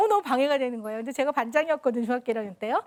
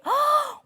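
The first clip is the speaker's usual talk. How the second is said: shocked gasp; 12 semitones higher, much slower than usual. Retold or imitated. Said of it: "이러더라고요".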